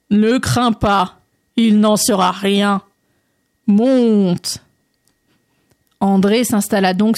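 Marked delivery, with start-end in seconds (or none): 0.1-4.6 slow, stretched-out frog voice; frog character. Acted. Frog reassuring André before he climbs on, slow drawl. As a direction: take your time, stretching the words.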